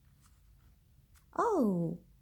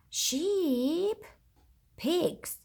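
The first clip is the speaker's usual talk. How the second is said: usual speaking voice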